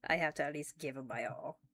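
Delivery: ordinary talk, nothing marked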